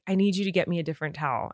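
low-pitched imitation of a serious husband; really serious. Husband asks wife for another towel, really serious. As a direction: imitate him, grave and understated. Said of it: "he was, like, really serious".

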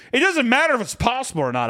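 high, heated objection; indignant protest. Acted. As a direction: argue back high at a disbelieving doctor. Protesting to a disbelieving doctor.

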